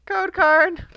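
high, loud wail of dismay. Real, not acted. Pack opener wailing at another code card. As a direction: wail it in comic despair.

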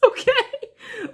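high laugh into okay. Real, not acted. Laughing at her own reading, high laugh.

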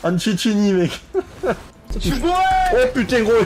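high-pitched excited yelps with laughter; hyped excitement. Real, not acted. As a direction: shout with delight at the play. Big play, streamer hyped. (excitation survoltée)

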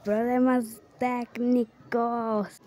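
slow, drawn-out announcing; mock announcement. Real, not acted. Player telling viewers of technical problems, drawn-out announcement. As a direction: stretch out every syllable for the viewers.

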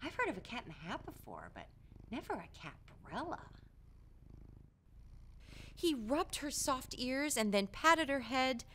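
usual speaking voice

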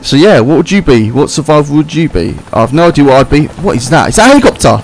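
ordinary talk

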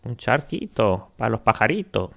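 usual speaking voice

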